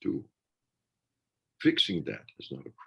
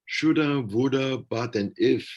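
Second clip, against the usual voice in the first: much higher and louder, singsong mockery; mocking regret list; 8 semitones higher, 5 dB louder than usual. Mocking the shoulda woulda of self-blame, higher and louder. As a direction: rattle off the regrets like a nagging chant.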